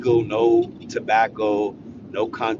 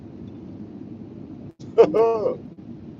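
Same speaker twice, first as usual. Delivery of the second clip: high unvoiced laugh; 13 semitones higher than usual. Real, not acted. Laughs at own story.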